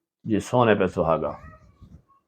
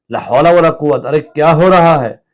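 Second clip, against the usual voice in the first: startled and louder; 4 semitones higher, 7 dB louder than usual. Acted. Sick husband startled by dust from sweeping, loud. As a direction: jolt awake at the dust. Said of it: "चौंक कर".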